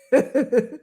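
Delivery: brief amused chuckle; short laugh. Real, not acted. Laughs after saying she's being quiet.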